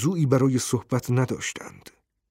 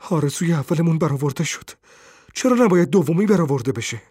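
fast, higher, insistent; feverish; 4 semitones higher, 3 dB louder, faster than usual. A narrator's reading. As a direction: argue feverishly, words rushing out. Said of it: "پیرزن با لحنی تبالود گفت".

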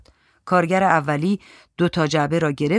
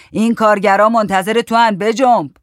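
raised, louder urging voice; shouting; 5 semitones higher, 7 dB louder than usual. A narrator's reading. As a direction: shout at him to hurry up. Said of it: "داد زد".

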